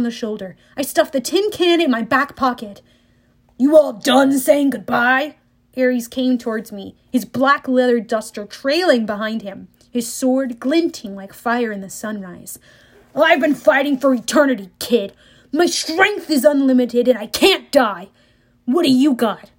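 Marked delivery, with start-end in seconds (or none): 3.6-5.4 menacing war god. Acted. War god Ares taunts Percy. 13.1-19.5 cocky, booming villain voice; a swaggering immortal bully; boastful war god. Acted. Ares voiced in fight scene.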